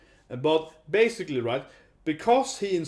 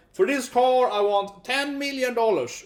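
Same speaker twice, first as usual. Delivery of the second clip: haggling seller; 8 semitones higher, 4 dB louder than usual. Retold or imitated. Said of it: "So I'm like".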